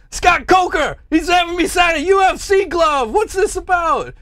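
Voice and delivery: high, loud hammed-up protest; mock yelling. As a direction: yell it like a fake-outraged fighter. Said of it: "Rampage starts yelling".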